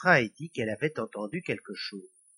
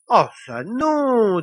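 higher, louder, long drawn-out no; indignant refusal; 7 semitones higher, 8 dB louder, much slower than usual. A narrator's reading. Lily refusing the devil's cruel plan, indignant. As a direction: refuse outright, stretching the vowel.

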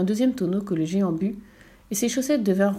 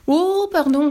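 high, loud "oh" of dismay; startled apology; 9 semitones higher, 7 dB louder, slower than usual. A narrator's reading. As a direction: exclaim in acted alarm at your blunder. Sorcerer realises his mistake.

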